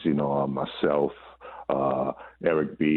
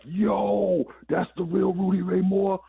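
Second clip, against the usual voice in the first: very high, amazed mock cry; high-pitched retold whoop of disbelief; imitated crazed excitement; slower than usual. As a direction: imitate onlookers losing it over a star. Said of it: "Yo, they went crazy".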